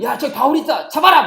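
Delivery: loud, high alarmed yell; soldiers' shout. Acted. Soldiers spotting Paul as they storm in, shouting. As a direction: shout it out to the troops.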